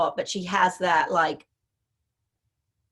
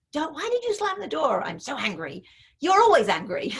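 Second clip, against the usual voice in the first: hammed-up scolding, higher, of a door-slamming teen; mock dismissive parent; 6 semitones higher than usual. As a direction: play the impatient parent, half-mocking. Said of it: "that kind of thing".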